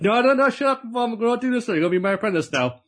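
high, pushy chef voice cutting him off; mock excited chef. Chef hushing his new apprentice, high and excited.

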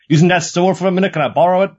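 mask seller's patter. Acted. Mask seller asking a loitering swordsman a favour, lightly acted patter. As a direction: pitch it up like a chatty stallholder.

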